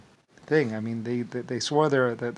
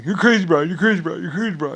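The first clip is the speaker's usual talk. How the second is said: mocking friends; 5 semitones higher, 9 dB louder than usual. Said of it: "is going".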